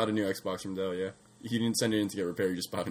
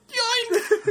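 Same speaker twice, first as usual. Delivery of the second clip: squeaky high cartoon exclamation; playful snatch; 4 dB louder than usual. Acting out a sneak attack in a game, high playful squeal. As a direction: pop it out in a sudden falsetto. Mimes sneak kill behind guards.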